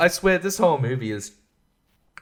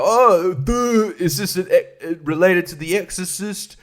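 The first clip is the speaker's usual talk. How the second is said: dumb viewer; 5 semitones higher than usual. Retold or imitated. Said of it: "something where you go".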